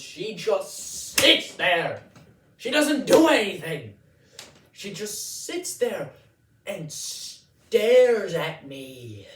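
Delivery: loud, high comic rant with drawn-out words; exasperated outburst. Acted. Comedian on the lawyer who just sits there, exasperated outburst. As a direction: cry it out in exaggerated disbelief.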